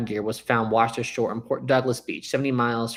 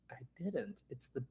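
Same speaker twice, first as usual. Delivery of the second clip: hushed, almost whispered; very quiet confession; 3 semitones higher, 17 dB quieter than usual. Retold or imitated. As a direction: confess it under your breath. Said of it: "And he goes".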